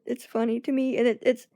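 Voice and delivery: ordinary talk, nothing marked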